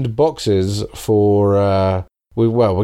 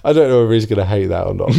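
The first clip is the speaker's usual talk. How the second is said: ordinary talk, nothing marked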